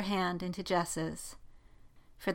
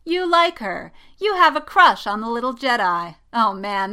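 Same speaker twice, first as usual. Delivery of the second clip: high, loud, excited crowing; gleeful teasing; 4 semitones higher, 10 dB louder than usual. A narrator's reading. Poe bounces over the discovery, gleeful. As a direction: crow over your friend's secret crush.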